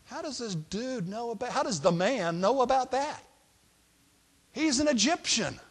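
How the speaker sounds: high-pitched, incredulous wondering; baffled. Acted. Brothers wondering how an Egyptian knows, baffled disbelief. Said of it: "they gotta be thinking".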